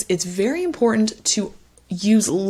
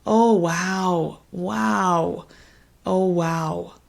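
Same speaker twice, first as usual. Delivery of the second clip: sympathetic oh wow; much slower than usual. Shown as a demonstration. Tutor shows a sad response to a struggling patient. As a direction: feel the weight of their struggle. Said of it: "expressed in a more negative tone".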